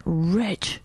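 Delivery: hushed, whispered name; warning whisper. Retold or imitated. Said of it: "I looked at you and I went".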